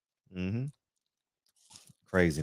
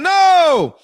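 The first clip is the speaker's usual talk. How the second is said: loud, high and slow preacher-like warning; mock Al pleading loudly not to sign; imitating Al; 11 semitones higher, 8 dB louder, slower than usual. A host mimics boss Al warning fighters, loud and slow. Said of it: "that's how Al talk".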